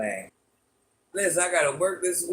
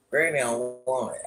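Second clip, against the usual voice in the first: ordinary talk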